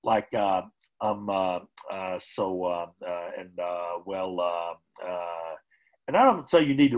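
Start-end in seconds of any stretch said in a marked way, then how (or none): 0.0-5.5 hesitant um and uh, fading; filler words. Shown as a demonstration. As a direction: trail off on every filler. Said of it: "avoid the use of filler words".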